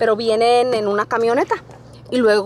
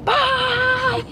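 high wailing yell of bye; shouted bye; 12 semitones higher than usual. Real, not acted. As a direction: holler it out like a crying farewell. Yelling bye out the window. (grito alto y lloroso de adiós)

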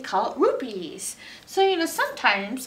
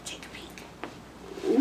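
whisper; 9 dB quieter, slower than usual. Pretend. Peeking into the bag secretly.

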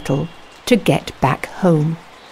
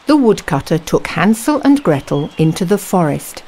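ordinary delivery

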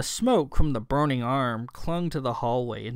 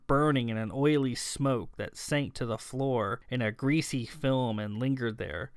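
ordinary delivery, nothing marked

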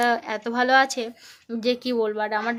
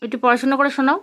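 put-on bright, loud sing-song quizzing of a toddler; loud child-directed teaching; 4 semitones higher, 7 dB louder than usual. Mother quizzing her toddler on letters, in a loud sing-song. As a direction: coax the little one through letters and numbers, loud and eager.

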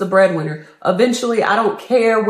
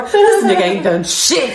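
ordinary talk, nothing marked

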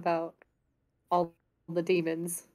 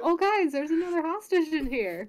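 calling out to party; 9 semitones higher than usual. Acted. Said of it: "Zarus turns around and goes".

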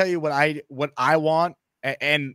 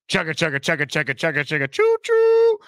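train sound. Acted. Host does train sound bit.

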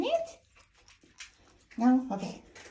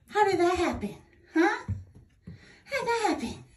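high sing-song pet talk; 9 semitones higher, 5 dB louder than usual. Real, not acted. Questioning pet about messy blanket.

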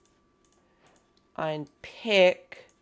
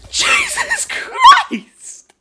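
high, loud, drawn-out laughing yell; laughing shriek; 12 dB louder, much slower than usual. Real, not acted. Player shocked after a mob ambush, shouting through laughter.

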